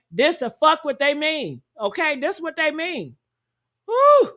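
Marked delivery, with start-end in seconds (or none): 3.9-4.3 high, loud whoop; sharp burst of a whoop; excited whoop. Real, not acted. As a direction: let out a high "woo". Reacts to what was heard.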